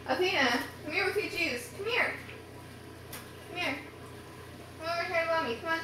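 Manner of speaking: high, soft call to a little one. Real, not acted. Mom coaxing toddler to come.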